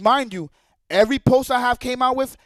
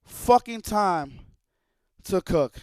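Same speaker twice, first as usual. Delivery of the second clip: mocking slow quote; 6 semitones lower, 5 dB quieter, much slower than usual. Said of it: "He said".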